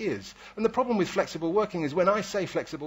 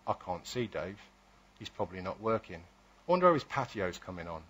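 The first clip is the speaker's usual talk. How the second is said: low, softer aside, imitating a doubting coworker; mock colleague's suspicion; 7 semitones lower, 5 dB quieter than usual. Office employee about colleague working from home, mock suspicion. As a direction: quietly grumble about an absent workmate.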